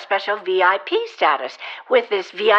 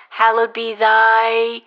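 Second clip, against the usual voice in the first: drawn out, slow and louder; slow password prompt; 4 dB louder, slower than usual. Acted. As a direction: spell out the prayer slowly. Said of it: "finish this password".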